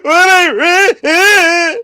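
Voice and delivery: high, loud, tearful yell; mock outraged shout. Teen yells at mom over a sad book. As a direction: shout it back, affronted. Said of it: "I was like".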